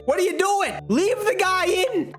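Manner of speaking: high-pitched protest at the manager; high, incredulous sports-fan outburst; exasperated fan yell. Acted. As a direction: yell at the coach through the TV. Fan yells at the manager's decision.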